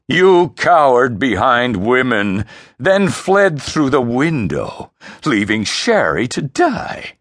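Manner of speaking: slow, loud, high contempt; heavy, scornful accusation; twisted in disgust. Acted. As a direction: spell out his cowardice with revulsion. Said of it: "voice twisted in disgust".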